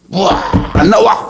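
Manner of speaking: high-pitched mock exasperation; hammed-up impatient outburst; acted impatience. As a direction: exclaim like someone desperately waiting. Acting desperate person waiting outside toilet.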